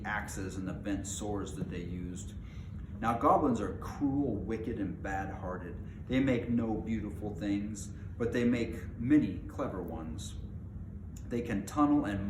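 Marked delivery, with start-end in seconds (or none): none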